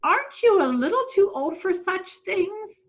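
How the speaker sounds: high, prim voice of a fussy lady; tut-tut at a naughty child; prim scolding guardian. Acted. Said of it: "Angela Throgmorton often asked".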